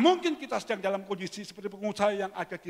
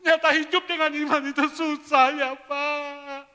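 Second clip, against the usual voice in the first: mock crying complaint; 10 semitones higher, 11 dB louder than usual. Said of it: "sambil nangis".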